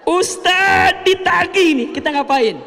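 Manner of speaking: high, loud, slow cry of alarm; mock panic. Woman panicking when the debt collector comes, mock loud alarm. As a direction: play the panic of being billed.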